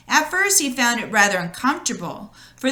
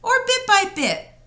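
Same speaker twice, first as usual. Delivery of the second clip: curious rabbit; 12 semitones higher than usual. Acted. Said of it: "he asked".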